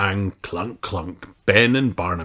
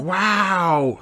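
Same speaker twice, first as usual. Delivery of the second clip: surprised gasp; 11 semitones higher than usual. A narrator's reading. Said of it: "the children gasp, surprised".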